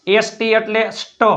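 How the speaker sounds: ordinary delivery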